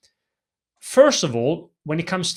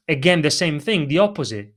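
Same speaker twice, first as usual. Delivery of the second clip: normal talking voice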